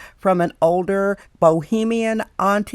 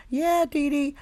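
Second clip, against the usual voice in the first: put-on higher, small little-girl reply; small girl's voice; 8 semitones higher, 3 dB quieter than usual. Retelling the little girl Jane answering Dee Dee, in a higher, small voice.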